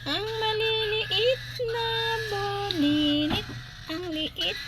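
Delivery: high, slow cooing; cooing over chicks. Real, not acted. Owner cooing at the tiny chicks, high and slow. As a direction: coo softly up high over the tiny ones.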